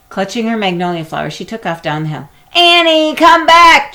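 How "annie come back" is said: loud, high desperate shout. A narrator's reading. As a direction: yell after her down the hill. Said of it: "Jack shouted".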